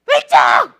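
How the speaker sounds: sudden full-voiced shout from the passenger seat; abrupt, very loud yell; shouted direction. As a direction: imitate a nervous passenger yelling directions. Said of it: "막 소리를 지르는 거예요".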